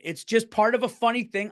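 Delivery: normal talking voice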